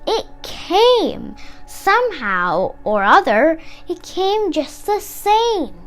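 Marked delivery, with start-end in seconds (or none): none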